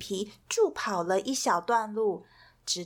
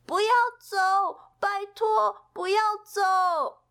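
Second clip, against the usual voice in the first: high, louder pleading cry; begging; 10 semitones higher, 4 dB louder than usual. Acted. Pip begs the vanishing rainbow not to leave, high and pleading. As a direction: call after the rainbow, begging it to stay. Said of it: "皮皮哀求着对消失的彩虹说".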